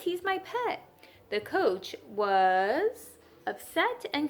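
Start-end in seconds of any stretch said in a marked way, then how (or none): 0.0-0.8 put-on boy pleading. A narrator's reading. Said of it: "said Louis".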